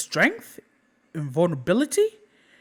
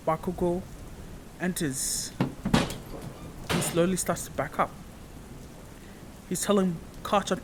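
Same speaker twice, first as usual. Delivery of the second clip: usual speaking voice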